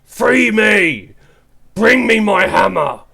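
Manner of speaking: loud, booming demonic voice; demonic shout. Acted. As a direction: roar it up from the chasm. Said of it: "it just shouts".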